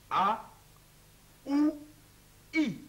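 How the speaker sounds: A, ou, I each set apart and shaped with the mouth; walk through the three vowels like a teacher; vowel series demo. Shown as a demonstration. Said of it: "pour la voyelle I". (A, ou, I, articulés un par un)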